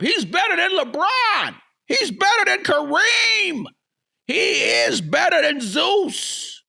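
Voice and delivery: hammed-up high hype voice; mocking hype fan. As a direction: parody the overexcited fans. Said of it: "crowd hyping Victor".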